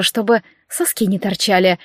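usual speaking voice

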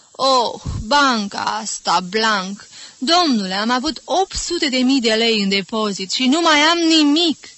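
loud, high lament over lost money; lamenting the bank. Acted. Mother bemoaning her lost savings to Jim, loud and high. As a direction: wail about the ruined bank account.

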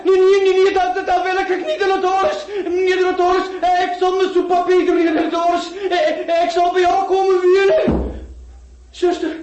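very high, loud terrified screaming; panicked shrieking. Acted. Terrified patient begging.

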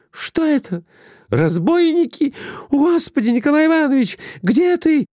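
high-pitched, panicked cries of alarm; frightened cry. A narrator's reading. Wife wakes to strangers with lanterns, frightened high cry. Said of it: "испуганно закричала".

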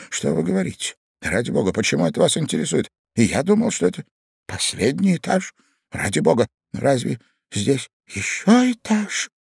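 stirred-up voice, rising in alarm; startled Ivan. A narrator's reading. Ivan under the bed questioning the young man, startled. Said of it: "прошептал встрепенувшийся Иван Андреевич".

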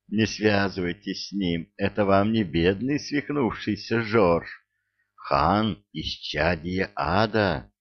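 ordinary delivery

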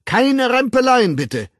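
loud, high blustering order. A narrator's reading. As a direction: bellow at a jostling crowd, then drop on the last word. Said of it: "polterte der stellvertretende Subschatzcontroller".

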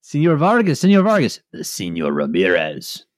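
hammy fake Mexican accent, rising; mock Mexican accent. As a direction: ham up Señor Vargas. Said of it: "in a Mexican accent".

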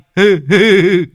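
high, loud guffaw; loud laugh. Acted. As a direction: burst out laughing. Lâm laughs after his joke. (cười hô hố to)

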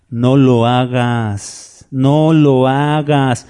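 very slow, drawn-out warning; slow admonition. Acted. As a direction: stretch each word, gently firm in forbidding. Angel forbids John's kneeling.

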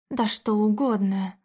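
usual speaking voice

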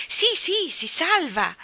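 high, loud burst of joy; joyful cry. A narrator's reading. Nelio answering Michael about Tiger Tim, with a joyful cry. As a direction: cry out in delight, starting way up high. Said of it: "gridò Nelio con un grido di gioia".

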